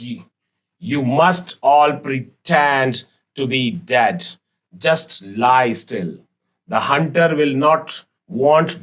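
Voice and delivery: slow, expressive reading of the English story. Acted. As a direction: read each line slowly and clearly to the class. Teacher reads story aloud slowly. (धीमी, भावपूर्ण कहानी वाचन)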